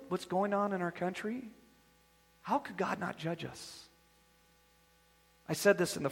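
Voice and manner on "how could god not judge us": soft, low, musing question; quiet question. Acted. Preacher musing quietly to the congregation, soft and low. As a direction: ask it softly to yourself.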